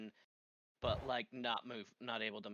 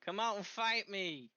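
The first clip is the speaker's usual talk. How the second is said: higher-pitched mock shout into the distance; shouting challenge; 8 semitones higher, 4 dB louder, slower than usual. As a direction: call out a playful in-character challenge. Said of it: "wander around shouting".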